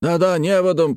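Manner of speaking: high eager agreement; high called-out assent; joining the cries. A narrator's reading. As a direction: chime in urgently from the crowd. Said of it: "подхватили другие".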